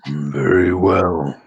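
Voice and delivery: low, slow shaman intoning; deep ritual orc priest. Acted.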